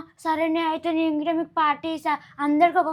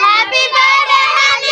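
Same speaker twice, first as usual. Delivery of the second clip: loud, high, drawn-out birthday wish; loud birthday cheer; 5 semitones higher, 8 dB louder, slower than usual. Acted. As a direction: cheer it out slowly for the crying friend. Told to wish the crying girl. (గట్టిగా, సాగదీస్తూ శుభాకాంక్షలు)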